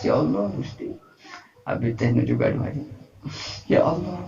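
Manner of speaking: soft, mock-tearful plea to God; pretend crying. Shown as a demonstration. Preacher shows congregation how to fake tears in prayer, pretend crying. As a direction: put on a hushed, weepy prayer. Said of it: "pura-pura nangis".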